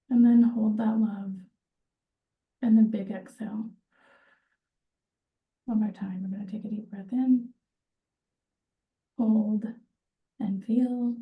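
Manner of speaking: calm guided breathing with whispered cues; guided meditation. Acted. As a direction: ease the group into each breath gently. Leads a breathing exercise.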